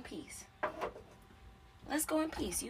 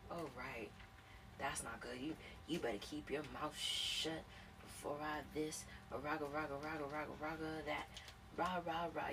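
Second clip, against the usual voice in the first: muttered mock threat; 3 semitones lower, 8 dB quieter than usual.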